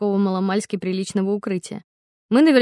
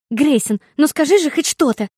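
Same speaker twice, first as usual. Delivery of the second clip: higher, louder insistent; demanding; 6 semitones higher, 5 dB louder than usual. A narrator's reading. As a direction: demand an answer. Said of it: "требовала Флоренс". (требовательно)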